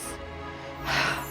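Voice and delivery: long breathy sigh; exhausted exhale; heavy sigh. Acted. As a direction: let out a weary breath. Mary sighs after her outburst.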